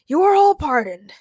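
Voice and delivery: lofty, generous proclamation; gracious declaration. A narrator's reading. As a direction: pronounce it like a royal pardon. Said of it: "King Ray graciously declared".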